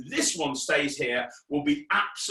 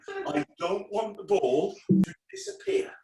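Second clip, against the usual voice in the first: normal talking voice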